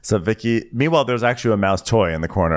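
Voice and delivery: usual speaking voice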